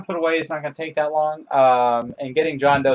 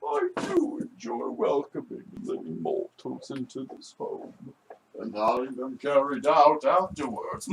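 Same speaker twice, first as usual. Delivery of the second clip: Skeeves the Butler. Acted. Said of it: "pretty good Skeeves the Butler impression".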